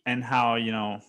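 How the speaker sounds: ordinary delivery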